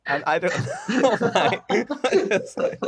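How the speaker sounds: high voice breaking into real laughter; laughing. Real, not acted. Cohost recalls a scene that cracked her up, laughing. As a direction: talk through giggles.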